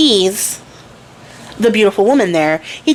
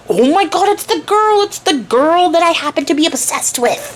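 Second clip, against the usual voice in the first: higher, breathless infatuation; smitten prince; 7 semitones higher than usual. Retold or imitated. The prince spotting his crush at the ball, gushing higher. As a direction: gush like you just saw the one.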